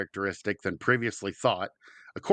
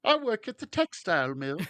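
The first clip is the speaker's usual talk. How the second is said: higher, lightly voiced Cockney worker; attempted Cockney; 7 semitones higher than usual. Playing a mill worker in the London bit, in a shaky attempt at Cockney. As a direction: aim for a Cockney mill hand and miss a bit. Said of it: "That was, that was sort of Cockney".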